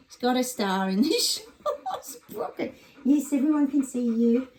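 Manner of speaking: doting dog talk. Real, not acted. Showing off her dog on camera.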